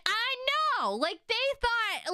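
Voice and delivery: high-pitched, exasperated "I know!"; excited agreement. Real, not acted. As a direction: burst out agreeing, pitch shooting up. Emphatic agreement with viewer's comment.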